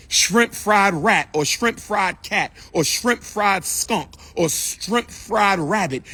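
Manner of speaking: slow, drawn-out list of dishes; slow teasing rundown of the dishes; slow mocking list. Real, not acted. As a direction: savour each absurd dish slowly.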